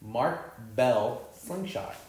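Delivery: slow, spaced-out product name; deliberate repeat. Shown as a demonstration. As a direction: say it again, very slowly. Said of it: "say it again".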